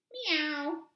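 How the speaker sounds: chirpy little meow for the silly chicken; mock cat meow.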